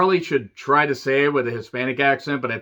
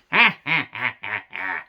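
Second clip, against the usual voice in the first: put-on staccato cackle, falling in pitch; Seth Rollins laugh; 6 semitones lower than usual. Podcaster imitating a wrestler's laugh, staccato cackle. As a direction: mimic a villainous wrestler's repeated "ha" laugh. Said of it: "it's Seth Rollins' laugh".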